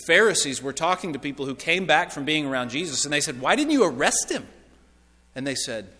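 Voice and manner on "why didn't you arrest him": higher-pitched, incredulous question; indignant demand. Acted. Pharisees questioning those who came back empty-handed, indignant demand. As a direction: demand an explanation, pitch jumping up.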